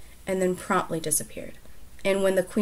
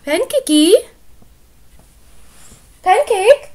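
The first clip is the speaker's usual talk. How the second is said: loud high call; calling the cat; 13 semitones higher, 14 dB louder than usual. Real, not acted. Owner calling her cat Pancake, loud and high. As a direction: call the cat from across the room.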